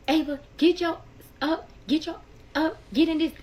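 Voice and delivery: imitated cursing out. Dad ordering daughter out from under the bed, imitated cursing out. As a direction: retell a dad yelling orders at his kid. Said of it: "he's cursing her out".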